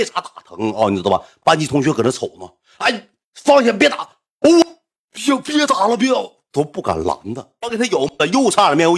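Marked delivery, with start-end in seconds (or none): 2.8-6.3 imitated classmates pleading. Classmates trying to stop fight.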